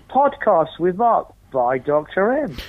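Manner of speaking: slow, drawn-out "Podcasts" and "by Doctor M"; slow grand title read; slow title reveal. Shown as a demonstration. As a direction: savour the title slowly. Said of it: "a bit of a play on".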